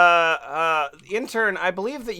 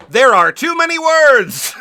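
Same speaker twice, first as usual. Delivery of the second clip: loud exclamation; 6 semitones higher, 9 dB louder than usual. Real, not acted. Reacting to huge wiki page.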